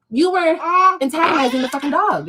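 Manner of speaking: usual speaking voice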